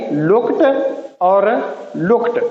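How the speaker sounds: लुक्ड ending in t, lookt. Shown as a demonstration. Teacher showing that लुक्ड ends in a t sound. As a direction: close लुक्ड on a sharp t. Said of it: "टी की sound इसमें आएगी".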